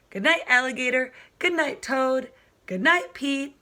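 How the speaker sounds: usual speaking voice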